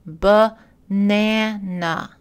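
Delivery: "Banana" very slow, syllable by syllable, in English; slow English demo of "Banana", reduced outer vowels; English syllable stress. Shown as a demonstration. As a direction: stretch out English "ba-NA-na", stressed middle. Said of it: "prononcé différemment selon qu'il est accentué".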